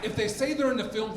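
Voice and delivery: usual speaking voice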